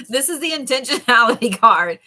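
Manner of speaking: a real giggle breaking the line; laughing through it. Real, not acted. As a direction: fight back giggles while naming it. Restarting after laughing fit.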